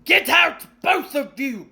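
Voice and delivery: loud, high burst then dropping; croaked shout. A narrator's reading. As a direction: throw the boys out. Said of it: "croaked Uncle Vernon".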